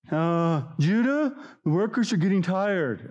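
high, nagging whine to the leader; whiny complainer. Retold or imitated. Complaining people nagging Judah, high and whiny. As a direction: pester Judah with a gripe.

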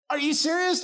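ordinary talk

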